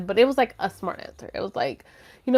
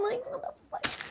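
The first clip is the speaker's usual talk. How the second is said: exasperated squeak; 14 dB quieter than usual. Real, not acted. Exasperated aside.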